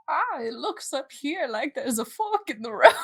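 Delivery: a hint of creaky old guide; very old man. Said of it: "I was gonna do an old man voice".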